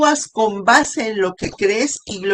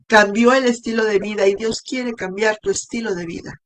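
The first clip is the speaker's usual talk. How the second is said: ordinary talk, nothing marked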